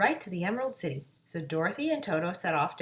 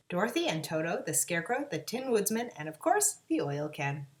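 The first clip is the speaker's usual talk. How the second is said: usual speaking voice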